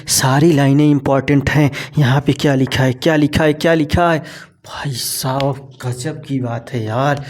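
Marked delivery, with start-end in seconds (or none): 4.7-7.2 hushed amazement. Real, not acted. Teacher marvels at the text.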